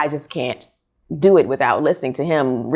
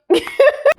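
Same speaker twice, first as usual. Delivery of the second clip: amused laugh; 3 dB louder than usual. Real, not acted. Laughs at daughter's video mention.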